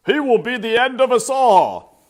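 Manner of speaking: loud, high furious shout. Acted. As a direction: bellow it waving the whisker. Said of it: "he shouted".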